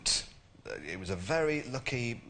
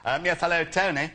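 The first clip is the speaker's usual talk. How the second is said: lightly voiced Hague greeting; a hint of stiff Tory politeness; William Hague impression; 8 semitones higher, 8 dB louder than usual. Prank caller as Hague greeting the Prime Minister. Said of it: "the William Hague impression".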